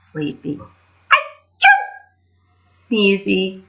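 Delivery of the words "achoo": high loud sneezing burst; loud high achoo; big sneeze. Acted.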